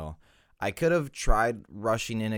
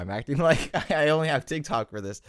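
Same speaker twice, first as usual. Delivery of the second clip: higher, louder, breaking into a laugh; laughing at self; 10 semitones higher, 8 dB louder than usual. Real, not acted. Host mocking own claim about socials, amused laughing. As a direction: laugh through your own silly slip.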